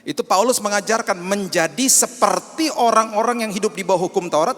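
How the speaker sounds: loud, high, fervent preaching; preaching at full force. Acted. Pastor proclaiming Paul's teaching to the church, fervent. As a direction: drive the point home to the congregation.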